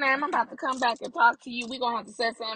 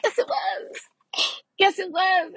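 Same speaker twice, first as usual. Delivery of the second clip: high, crying-tinged protest, louder on the second; mock whiny insistence; 11 semitones higher, 3 dB louder than usual. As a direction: plead it like a sulky kid. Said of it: "And I'm like".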